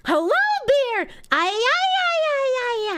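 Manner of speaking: a daft bar-room toast; silly cheer. Pretend. Greeting a beer with a goofy high cheer.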